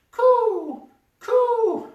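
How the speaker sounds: very high, far cooing call; seagull coo. Acted. Mistaken for a seagull, cooing. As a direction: coo like a bird.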